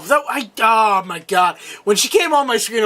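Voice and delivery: usual speaking voice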